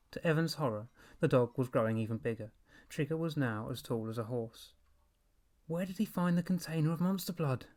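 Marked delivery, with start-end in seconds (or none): 5.7-7.7 anxious wondering. A narrator's reading. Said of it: "Evan wondered".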